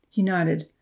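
ordinary delivery, nothing marked

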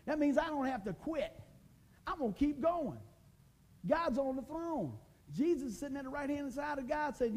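excited pulpit preaching; full-force preaching. Real, not acted. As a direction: preach it out, lifting the room.

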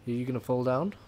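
ordinary talk, nothing marked

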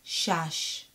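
Shash said slowly with a falling tone; careful teacher demo of "Shash"; slow clear syllable. Shown as a demonstration. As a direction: model "Shash" for the student to repeat. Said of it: "So it's going to be shash".

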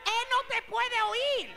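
loud, high, driving sermon voice; preaching at full force. Real, not acted. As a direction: hammer each phrase out to the congregation. (predicación encendida, fuerte y alta)

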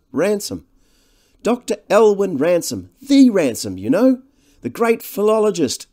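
high, loud, gushing society man; jovial host. Acted. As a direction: introduce your old friend with great fuss. Devine's lines in the novel.

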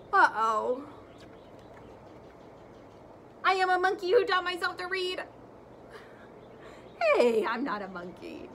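lively, animated read-aloud; reading to children. Acted. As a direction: read it big for the kids. Teacher reads the book aloud expressively.

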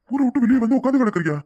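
taken aback, pitch jumping up; alarmed. Acted. Alarmed at lover leaving home.

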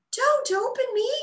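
tiny high voice pleading; pleading flower. A narrator's reading. A flower pleading with Bella, tiny high voice. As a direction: beg like a small scared bud. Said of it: "the flower pleaded".

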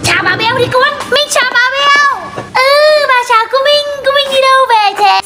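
put-on high, sing-song baby-directed talk; greeting a child. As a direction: lift the voice for a little one. Adults greeting through a small child. (giọng nựng trẻ con)

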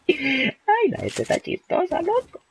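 high, amused voice; amused squeal. Real, not acted. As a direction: squeal it out, tickled by chat. Amused by chat.

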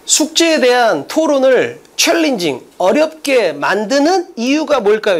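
slow, stretched-out phrase by phrase; measured teacher's model reading; deliberate reading demo. Shown as a demonstration. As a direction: read it out slowly, weighing each chunk. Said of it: "이렇게 읽는 거야".

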